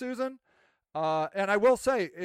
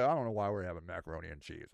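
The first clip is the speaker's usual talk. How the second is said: low mock grumble; 13 semitones lower, 7 dB quieter, faster than usual. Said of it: "they were like".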